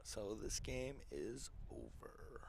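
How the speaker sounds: soft, slow whisper. Real, not acted. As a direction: breathe it out under your breath. Quiet aside as game ends.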